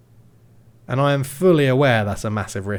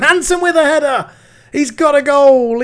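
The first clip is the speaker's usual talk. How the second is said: high, loud goal yell; goal shout; 12 semitones higher, 9 dB louder than usual. Real, not acted. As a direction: scream the goal.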